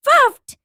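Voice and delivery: a hard puff of breath; blowing out hard. Shown as a demonstration. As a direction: blow out like strong wind. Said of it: "Blow as hard as you can".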